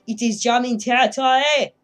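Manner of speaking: loud, emphatic mock French accent. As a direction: exclaim it in a hammed-up French accent. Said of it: "I don't know why I did a French accent".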